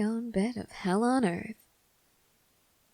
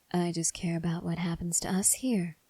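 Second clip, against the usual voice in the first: cold whispered threat; menacing whisper. Acted. Whispered dismissal continues.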